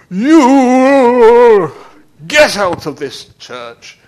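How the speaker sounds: loud high pompous churchman shouting him out; pompous priest yell. Retold or imitated. Priest shouting the knight out of his church, loud and pompous. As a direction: bellow like an outraged priest.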